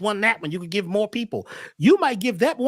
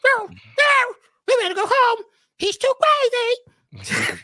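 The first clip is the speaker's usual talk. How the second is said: scared dogs talking; 12 semitones higher than usual. Retold or imitated. The junkyard dogs fleeing the naked man, mock panicky squeal. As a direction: squeal it like frightened dogs who want to go home. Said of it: "They said".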